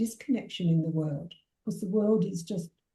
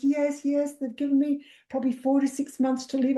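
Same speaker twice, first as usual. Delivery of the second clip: higher, lightly voiced frantic client; panicked client; 6 semitones higher than usual. Client Glad answering about her prognosis, higher and anxious. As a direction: play the scared client, pitched up. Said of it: "She goes".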